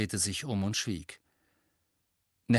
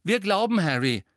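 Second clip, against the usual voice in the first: high-pitched, unadorned declaration; simple statement; 8 semitones higher, 5 dB louder than usual. A narrator's reading. Neville declaring his family believes Harry, simply. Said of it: "sagte er schlicht".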